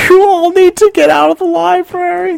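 very high-pitched, put-on woman's voice; high mock plea, breaking into a laugh early; kinda panicky announcer. Loudspeaker woman telling students to leave, mock panicky. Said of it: "she's kinda panicky".

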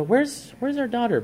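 high, lightly voiced wife asking; mother asking for daughter. Wife at home asking where her daughter is, lightly voiced and high. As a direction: lift it high like the worried wife.

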